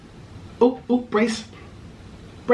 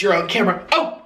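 ordinary talk

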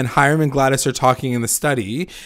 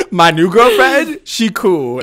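mock dopey Archie voice, slow and louder; imitating Archie; 6 semitones higher, 6 dB louder, slower than usual. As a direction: ham up the lovestruck boy, drawn out. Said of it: "basically says to Elio, like".

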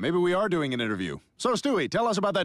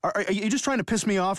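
ordinary talk, nothing marked

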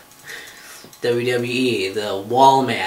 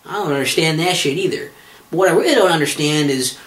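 normal talking voice

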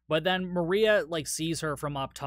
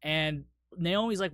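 usual speaking voice

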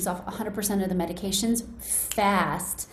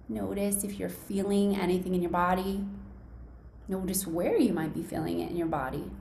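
hushed and close, easing them into calm; right in your ear; 2 semitones lower, 6 dB quieter than usual. Acted. Leads a guided body meditation.

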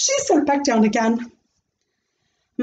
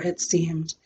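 ordinary delivery, nothing marked

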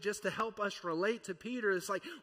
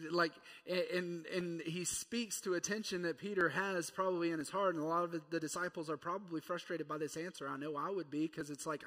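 ordinary delivery

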